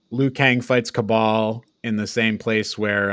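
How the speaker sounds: ordinary talk, nothing marked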